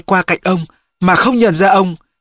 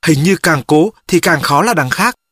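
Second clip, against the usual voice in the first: ordinary delivery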